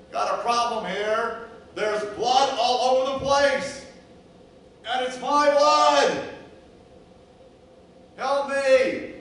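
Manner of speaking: high, loud desperate cries for help; crying out in anguish. Acted. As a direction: scream for help as a wounded sheep in pain. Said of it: "cries out in anguish and pain at the top of its lungs".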